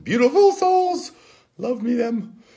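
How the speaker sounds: a high, cutesy burst of affection; gushing squeal. Real, not acted. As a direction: squeal it with delight. Gushing about members.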